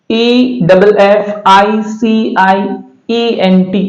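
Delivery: slow careful spelling; teacher spelling each letter; spelled slowly. Shown as a demonstration. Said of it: "इसको पढ़ा जाएगा".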